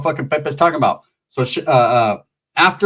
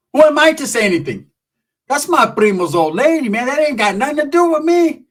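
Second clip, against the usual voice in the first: mock innocent; 9 semitones higher than usual. Acting out primo's attitude.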